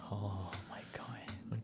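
soft, slow whispered "oh my God". Real, not acted. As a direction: breathe it out in disbelief. Reacting to sad news.

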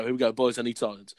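ordinary delivery, nothing marked

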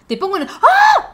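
high, loud, slow squeal through the line; squealing quote. Real, not acted. Friend squealing while quoting the teacher's line, high and loud. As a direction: break off into a shriek.

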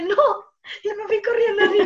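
high, laughing mock panic; hammed-up fright through laughter; retold panic. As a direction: act out fleeing, amused by it. Retold panic at being chased.

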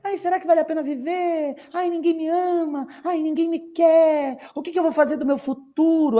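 high, fast mock self-pity; mock your old neurotic self; whiny teen self. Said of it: "Um monstrinho de óculos, cheio de problemas". (autocomiseração chorosa)

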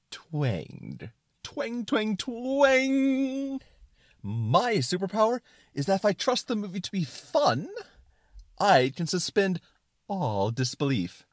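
high, loud triumphant twanging; excited suspenders. Acted. Suspenders of disbelief declaring its superpower, excited and triumphant. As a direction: build the twangs, then proclaim it grandly. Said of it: "talk in an excited tone".

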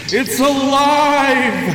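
drawn-out high maniacal shriek; maniacal cry. Acted. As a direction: shriek it high and slow like a madman. Mad scientist's triumph.

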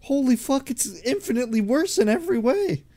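high, disbelieving exclamation; can't believe what he just heard; incredulous outburst. Real, not acted. Friend rejects the past, high-pitched disbelief. Reacting to friend choosing the future.

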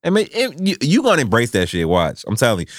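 normal talking voice

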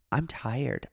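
softly retold weariness; exhausted. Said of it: "I was so exhausted".

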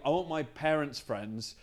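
usual speaking voice

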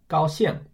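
高兴 with 高 high and level, 兴 falling; first tone, fourth tone. Shown as a demonstration. Teacher demonstrating the word for happy, first then fourth tone. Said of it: "first tone, fourth tone".

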